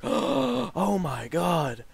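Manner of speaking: slow, drawn-out shocked gasp of a crowd; stunned onlookers. Retold or imitated. Imitating classmates watching the spit, slow stunned murmur. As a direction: let it hang in mild disbelief.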